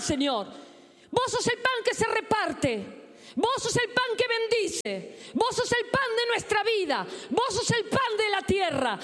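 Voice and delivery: high, drawn-out impassioned cries; fervent prayer preaching. Real, not acted. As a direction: plead with God before the congregation. (oración ferviente, aguda y sostenida)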